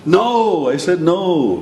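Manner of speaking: high, loud drawn-out "No"; exasperated refusal. Acted. Parent pestered over and over, exasperated shout. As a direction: burst out as patience finally snaps. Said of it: "somebody's really bothering you".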